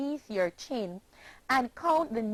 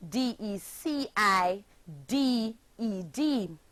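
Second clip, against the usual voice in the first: ordinary talk, nothing marked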